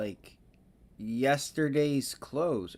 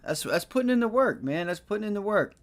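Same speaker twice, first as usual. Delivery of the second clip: excited; 7 semitones higher than usual. Real, not acted. Streamer praises the hard work.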